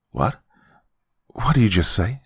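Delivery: taken-aback question, rising at the end; mildly stunned, halting double question; shocked. A narrator's reading. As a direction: ask it in disbelief, voice lifting. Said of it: "I asked in a shocked tone".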